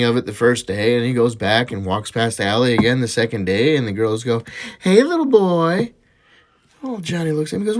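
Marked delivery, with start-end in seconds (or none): 4.8-5.9 slow, higher-pitched singsong call; teasing catcall. Acted. Prostitutes calling to a boy passing their alley, teasing catcall. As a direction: draw it out like a teasing come-on.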